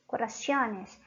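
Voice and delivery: corazones with a false i-glide, "co-ra-SYO-nes"; wrong plural. Shown as a demonstration. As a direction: say corazones as the mistaken "coraciones". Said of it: "No existe corazones".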